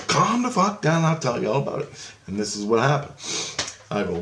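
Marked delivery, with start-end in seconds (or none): 0.1-2.1 high-pitched mock exasperation; exasperated calming. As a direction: rein in a panicking friend, retold with emphasis. Retold telling panicked friend to settle.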